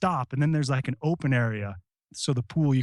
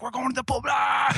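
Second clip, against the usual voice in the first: rally shout; 9 semitones higher than usual. Retold or imitated. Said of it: "I'm like".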